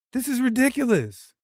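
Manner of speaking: exasperated. Shown as a demonstration. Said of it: "just say".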